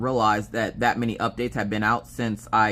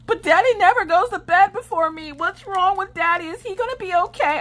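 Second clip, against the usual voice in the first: put-on worried little girl; 4 dB louder than usual. Daughter asking mom about dad, high worried child voice. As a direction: ask like a worried small child.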